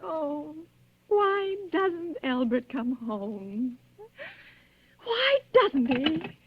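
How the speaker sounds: slow, crying voice, breaking on why; tearful plea. Acted. Bedridden wife, alone at night, crying for her husband, slow and tearful. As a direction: let the tears through as you beg the empty room.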